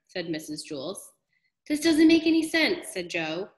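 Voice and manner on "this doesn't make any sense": put-on high, loud exasperated child; boy throwing up his hands; frustrated kid. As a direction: Joe bursts out in confusion, pitched up. Joe frustrated.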